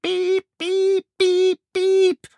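very high, loud, even beeps; shrieking smoke alarm. Acted. Smoke alarm screams in Albert's ears, shrieking beeps. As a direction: imitate an alarm with piercing, sustained pips. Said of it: "Lyden skriker i ørene til Albert".

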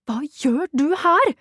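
high, slow, indignant question; startled outcry. A narrator's reading. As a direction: demand it in drawn-out alarm. Startled narrator confronts intruding cousin.

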